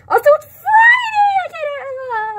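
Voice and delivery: real thrilled shout; excited shout. Real, not acted. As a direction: yell it with delight. Excited Friday outburst.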